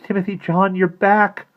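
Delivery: high, loud, amazed cry; gasped. A narrator's reading. Maggie finds Timothy John back, high amazed exclamation. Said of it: "Maggie finally gasped".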